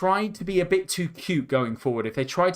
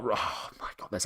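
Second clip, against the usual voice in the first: whispered aside; 5 semitones lower, 10 dB quieter than usual. Real, not acted. Aside mid commentary, under breath.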